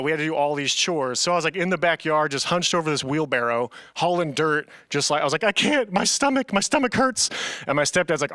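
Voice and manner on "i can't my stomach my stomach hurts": high-pitched retold whimper of complaint; whining in pain. Hurt boy whining to stepdad.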